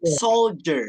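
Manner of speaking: "soldier" slowly as SOLE-jer; D dibaca J. Shown as a demonstration. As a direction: model "soldier" with a clear J sound. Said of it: "D-nya itu dibaca J". ("soldier" pelan, D diucapkan J)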